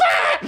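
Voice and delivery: short high-pitched shriek; startled scream. Acted. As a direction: cry out as if jumped by a ghost. Acted startled scream after demon voice.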